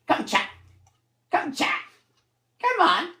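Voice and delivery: high coaxing voice for the cat. Real, not acted. Calling the cat Sid over to chat, high sing-song coaxing.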